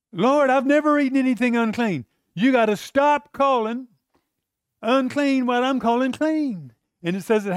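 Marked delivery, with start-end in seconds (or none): 0.1-2.1 raised, insistent protest; Peter protesting. Acted. Peter's third refusal. 2.4-6.7 high, altered voice, slowing at end; God insisting. Acted. God's voice, third time.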